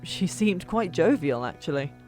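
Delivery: ordinary delivery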